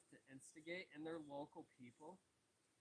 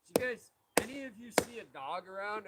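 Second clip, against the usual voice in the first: loud, higher calling voice; imitated worried owner; 6 semitones higher, 14 dB louder than usual. Dog owner asking bystanders, retold loud call. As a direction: call out to a group as if searching.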